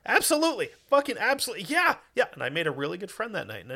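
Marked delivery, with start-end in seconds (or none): 0.0-2.2 put-on much higher, eager drunk yes-man; drunk agreeing. Agreeing with a drunk Scot, high and eager. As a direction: nod along to anything, drunk. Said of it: "I was just like".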